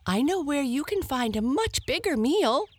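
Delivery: high, fast hare voice; the hare talking fast to save herself; eager hare. Acted. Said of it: "said the hare".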